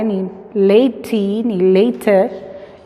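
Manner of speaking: latey and later said slowly as mistakes; wrong readings. Shown as a demonstration. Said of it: "no decimos".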